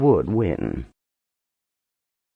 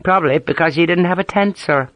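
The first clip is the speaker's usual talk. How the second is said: put-on Ulster accent; 7 semitones higher than usual. A narrator's reading. Harper joking in his accent.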